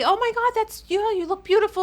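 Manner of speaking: high, loud excited gushing; gushing praise. Retold or imitated. Women chime in to praise gray hair, loud high gushing. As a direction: gush like an admiring friend, pitch way up.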